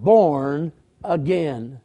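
"born again" drawn out slow and weighty; "born again" said at half speed with weight; emphasis. Acted. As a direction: lean slowly into "born again". Preacher stresses key phrase.

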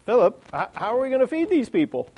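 lightly voiced Jesus putting him on the spot; Jesus testing Philip.

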